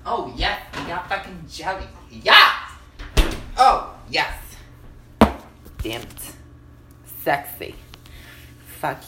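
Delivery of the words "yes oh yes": loud, triumphant cheer; excited yes. Real, not acted. Finding jelly in the fridge, loud excited cheer. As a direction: celebrate the find out loud. Jelly found for his sandwich.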